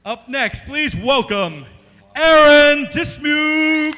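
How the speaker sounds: slow ring announcer, name belted out; wrestling announcer. Pretend. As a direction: draw out the name like a ring intro. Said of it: "I'm gonna sound like a wrestling announcer".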